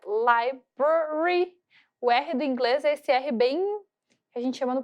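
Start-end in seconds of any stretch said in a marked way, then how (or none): 0.0-1.6 very slow "library" with strong English R; careful pronunciation demo; model pronunciation. Shown as a demonstration. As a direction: draw out "library", rolling the back R. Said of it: "Ó a pronúncia".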